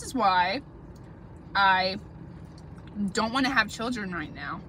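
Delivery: words between mouthfuls of food; speaking with partly full mouth; talking while chewing. Real, not acted. Seen 2: Forks salad into mouth, then speaks with mouth moving between bites.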